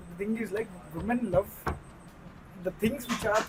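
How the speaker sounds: quietly explaining; too quiet. Real, not acted. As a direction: say it softly, under full volume. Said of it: "Be loud కొంచెం".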